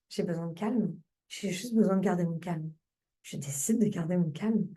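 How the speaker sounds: low, soft, soothing affirmation; calming self-talk. Shown as a demonstration. Coach models her tapping phrase for keeping calm, calming self-talk.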